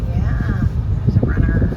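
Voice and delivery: loud. Real, not acted. Calling out in the tunnel.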